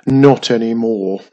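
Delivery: loud, mildly grumpy castle voice. A narrator's reading. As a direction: grumble it out heavily. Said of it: "said Fortress grumpily".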